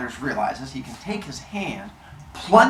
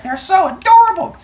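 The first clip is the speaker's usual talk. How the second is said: cooing mother; 7 dB louder than usual. Acted. Mother cooing over newborn babies.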